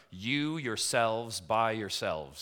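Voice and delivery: "you yourselves by yourselves" slow, each part weighted; emphatic wooden reading. Shown as a demonstration. Preacher reading a literal translation to listeners, heavily emphatic. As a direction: spell out "you yourselves by yourselves" slowly and deliberately. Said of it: "You see the emphasis there?".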